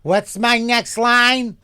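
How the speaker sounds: high, loud, drawn-out mock demand; actress calling for line. Award-winning actress on set asking for her line, loud mock wail. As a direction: stretch it out as an exaggerated cry. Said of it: "She was a Tony Award-winning fucking actress".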